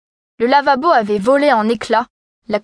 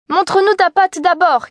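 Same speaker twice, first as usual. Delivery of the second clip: put-on kids crying out; 7 semitones higher than usual. A narrator's reading. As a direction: call out like wary little goats. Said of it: "crièrent les chevreaux".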